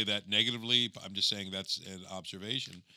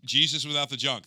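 excited; 6 semitones higher, 9 dB louder, faster than usual. Real, not acted. Recalling title excitedly.

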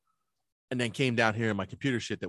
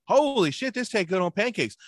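high-pitched, louder burst of discovery; amazed delight; 8 semitones higher, 3 dB louder than usual. Retold or imitated. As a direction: exclaim it as a retold revelation. Retelling tasting jam on pancakes.

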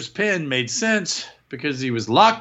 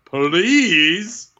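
high, loud, stretched-out "Please" rising at the end; "Please" milked long and loud, pitched up; drawn-out please; 7 semitones higher, 6 dB louder than usual. Acted. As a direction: draw out "Please" with a big upward swoop. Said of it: "saying please like he wants it".

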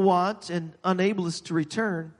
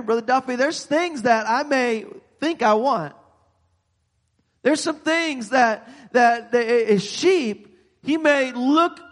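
higher, urgent preaching, voicing the sheep; raised preaching voice; 4 semitones higher than usual. Preacher voicing a tempted sheep to Brother Duffy, lifted voice. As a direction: lift the voice and plead with the church.

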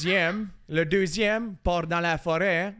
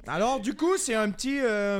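imitating a French-from-France accent, higher pitched; accent français de France; 3 semitones higher than usual. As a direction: put on a mock Parisian-style French accent. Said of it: "fais un accent français pour voir". (accent de France imité)

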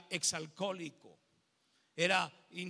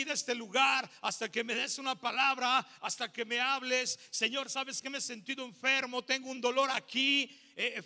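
fervent prayer; 6 semitones higher than usual. Retold or imitated. Pastor reenacting his insistent prayer to God, fervent. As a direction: beg God for a word. Prays for the sermon.

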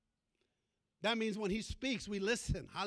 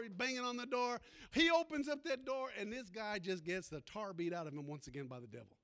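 ordinary talk, nothing marked

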